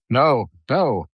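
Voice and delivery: ordinary delivery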